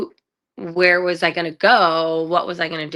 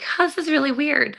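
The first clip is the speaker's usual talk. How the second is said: retold puzzled huh, pitched up high; a young model baffled by the odd wardrobe; 9 semitones higher than usual. High, puzzled huh to herself.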